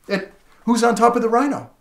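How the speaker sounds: ordinary talk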